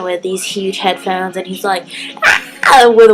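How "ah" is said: loud, higher "ah"; imitated vocal warm-up. Vlogger imitating rapper warming up with water bottle, loud. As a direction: belt out a mock warm-up note. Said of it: "he's, like, warming up".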